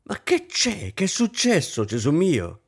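high-pitched, desperate wail of alarm; desperate cry. A narrator's reading. Hostess alarmed as the hours strike, desperate. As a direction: cry out in despair at what's happened. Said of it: "gridò disperata la signora Kampf".

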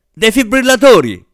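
high, loud emergency yell; urgent shout. A narrator's reading. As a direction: shout for the equipment in a crisis. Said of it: "urlò all'attrice". (urlo acuto d'emergenza)

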